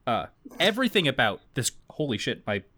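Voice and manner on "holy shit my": normal talking voice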